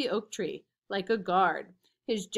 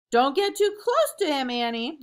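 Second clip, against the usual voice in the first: high, louder warning; anxious high warning shout; 5 semitones higher, 5 dB louder than usual. A narrator's reading. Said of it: "Jack shouted".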